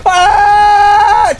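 very high, loud held scream; long scream. Real, not acted. Player in a game, long high scream. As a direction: scream and hold the note.

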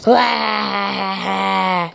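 usual speaking voice

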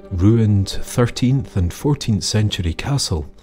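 usual speaking voice

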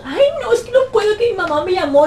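high, whiny falsetto excuse; squeaky mock excuse; mocking girlfriend. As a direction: parody a girl dodging him. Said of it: "girlfriend making excuses".

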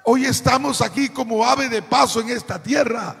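forceful, ringing sermon delivery; predicación a plena voz. Real, not acted. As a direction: preach it out, pushing each phrase to a strong close. (predicando con fuerza)

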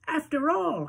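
put-on high, thin old-lady voice; old Grandma Josephine consoling Charlie, high and thin; grandmother voice. A narrator's reading.

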